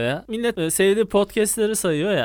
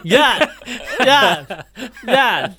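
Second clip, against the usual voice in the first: louder, laughing calls of gel; tout calling; 4 semitones higher, 4 dB louder, much slower than usual. Retold or imitated. Host imitates the shop tout calling customers in, loud and laughing. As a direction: beckon passers-by like a street tout.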